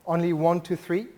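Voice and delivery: ordinary delivery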